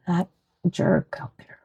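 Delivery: muttered whisper under breath. Real, not acted. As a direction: whisper it, annoyed. Annoyed at a passing car.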